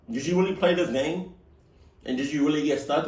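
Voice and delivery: ordinary delivery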